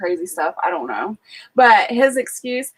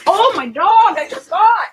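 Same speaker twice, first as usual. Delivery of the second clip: surprised; 8 semitones higher, 3 dB louder than usual. Real, not acted. Notices dog left outside.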